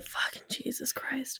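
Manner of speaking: whispered curse. Real, not acted. Exasperated aside after recalling role.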